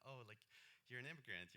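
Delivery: normal talking voice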